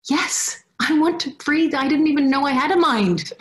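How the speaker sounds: high, thrilled and laughing; giddy excitement. Retold or imitated. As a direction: bubble over with yes. Excited reaction to the offer.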